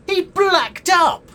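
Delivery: slow, very high, loud outrage; scandalised outburst. Acted. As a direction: cry it out, utterly appalled. Outraged at an actor's performance.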